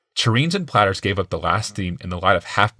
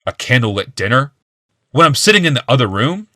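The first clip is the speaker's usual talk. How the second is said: angry accusation; 4 semitones higher, 5 dB louder than usual. A narrator's reading. Accusing wife angrily.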